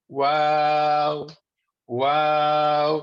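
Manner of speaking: "واو" drawn out long on a level note; slow, sustained Arabic "واو"; rounded-lip waw. Shown as a demonstration. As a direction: round both lips and stretch "واو". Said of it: "دونوں ہونٹوں کو جب ہم گول کرتے ہیں".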